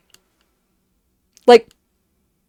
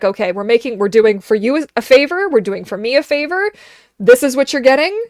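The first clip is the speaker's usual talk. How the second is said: louder, higher firm owner; talking to the dog; 4 semitones higher, 6 dB louder than usual. Retold or imitated. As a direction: lay down the law to the pet. Telling her dog about haircut.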